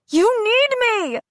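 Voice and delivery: high, slow desperate scream. A narrator's reading. Jocelyn screaming as she loses Adira, high and slow. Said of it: "Jocelyn screams".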